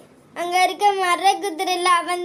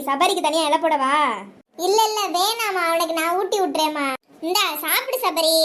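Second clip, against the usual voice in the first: ordinary delivery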